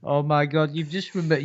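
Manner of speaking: normal talking voice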